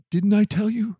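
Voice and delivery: high-pitched exclamation at normal volume; exclaiming. A narrator's reading. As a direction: exclaim it as an I-told-you-so. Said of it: "the king of Israel exclaimed".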